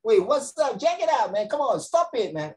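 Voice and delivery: high, excited American voice; mock American protester. Teacher mocks Americans refusing masks, high American voice. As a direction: ham up an American telling you to back off. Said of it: "You get me?".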